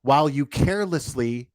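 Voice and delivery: normal talking voice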